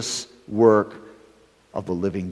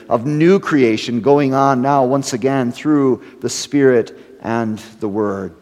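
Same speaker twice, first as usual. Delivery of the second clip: ordinary talk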